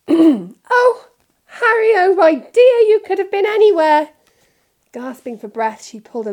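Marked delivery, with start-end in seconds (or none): 0.1-4.1 very high, loud motherly wail; overwrought, shrieky fussing; frantic relief. A narrator's reading. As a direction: cry out, nearly sobbing with worry. Frantic mother finds lost boy.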